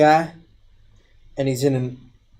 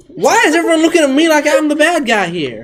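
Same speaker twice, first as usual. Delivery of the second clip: raised, aggrieved question; indignant protest; 12 semitones higher, 4 dB louder than usual. Acted. Suspicious warrior asks the party, higher and indignant. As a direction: feel unfairly accused. Defensive outburst.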